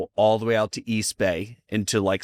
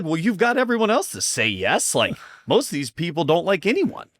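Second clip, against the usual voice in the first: mock boss voice; 6 semitones higher than usual. Boss on phone, amused.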